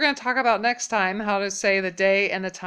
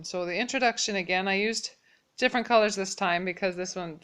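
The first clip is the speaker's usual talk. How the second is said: ordinary talk, nothing marked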